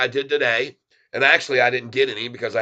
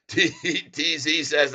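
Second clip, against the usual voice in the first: ordinary talk, nothing marked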